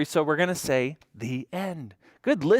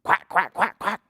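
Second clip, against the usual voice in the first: short, higher-pitched duck quacks; 6 semitones higher than usual. Acted. Storyteller playing the animal in the barn, duck quack. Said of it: "Here's the animal sound".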